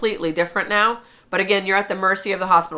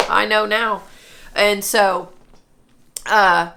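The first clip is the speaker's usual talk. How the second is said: normal talking voice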